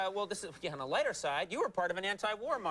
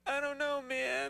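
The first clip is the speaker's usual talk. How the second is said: mock clueless stoner; 8 semitones higher, slower than usual. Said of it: "he'd be like".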